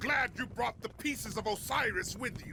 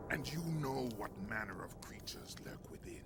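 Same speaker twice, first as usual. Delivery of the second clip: hushed, unvoiced and soft; ominous whisper; 8 semitones lower, 9 dB quieter than usual. Villain warns his general in a menacing whisper. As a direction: breathe it like a dark secret. Villain hints at underworld creatures.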